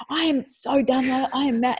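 hammed-up angry outburst, pitch up; mock mad child. Pretend child telling mom Lila, mock angry. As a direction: play the fed-up kid. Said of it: "you're reading me going".

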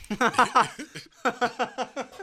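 loud high laugh through the words; cracking up mid-sentence. Real, not acted. Laughs while joking about guns.

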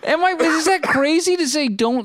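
high laughing question, voice cracking up. Real, not acted. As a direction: ask it while laughing hard. Laughing while asking.

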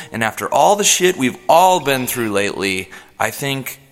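normal talking voice

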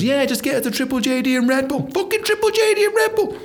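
mock cheeky mate. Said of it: "someone goes".

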